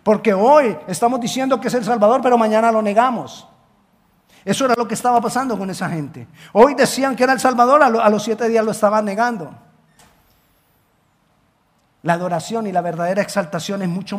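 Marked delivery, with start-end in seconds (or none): 0.0-9.5 fiery preaching. Acted. Preacher rebuking empty worship.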